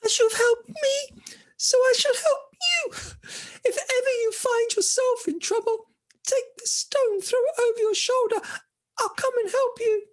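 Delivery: tiny squeaky mouse; very high, piping creature voice; high-pitched voice. Acted. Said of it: "said in a high-pitched voice".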